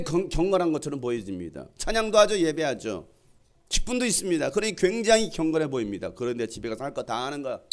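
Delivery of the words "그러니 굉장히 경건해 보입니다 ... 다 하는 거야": usual speaking voice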